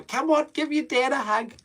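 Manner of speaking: high, goofy put-on dad voice; embarrassing dad. Dad teasing kids for a hug at school, high silly voice. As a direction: pitch it way up, playfully cloying.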